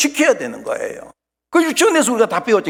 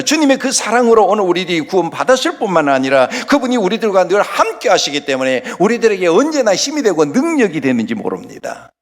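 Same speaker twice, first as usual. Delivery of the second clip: ordinary delivery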